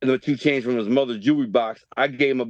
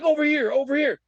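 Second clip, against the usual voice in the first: high, eager call for the ball; 10 semitones higher than usual. Retold or imitated. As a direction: shout for the pass, arms up. Calling for the ball.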